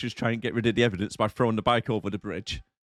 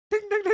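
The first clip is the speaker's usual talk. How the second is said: high bright imitated bell dings; ping it like a bicycle bell, way up high; bike bell ding; much faster than usual. Mimicking the bike bell she rode off with, high pinging.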